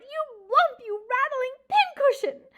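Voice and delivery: loud, high furious insults. A narrator's reading. Mouse furious at the porcupine, loud high insults. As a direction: hurl them at the porcupine.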